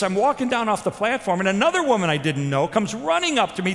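normal talking voice

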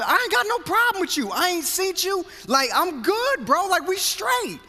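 hammed-up "we're fine, bro"; insistent reassurance. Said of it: "I would tell this brother".

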